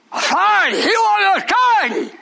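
preacher railing against a rival; emphatic shout. Acted. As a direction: hammer the word authority.

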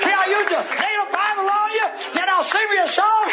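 high, ringing revival delivery; full-force preaching. Real, not acted. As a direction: sing out each phrase in a preacher's cry.

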